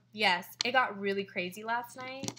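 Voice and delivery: ordinary talk, nothing marked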